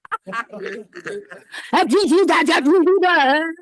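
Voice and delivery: joyful babbling gibberish. Shown as a demonstration. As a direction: babble nonsense syllables with joy.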